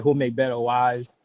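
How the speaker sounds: ordinary delivery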